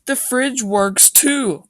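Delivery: high, slow, excited shout; excited cry. A narrator's reading. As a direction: call it out delighted. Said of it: "Carson cried".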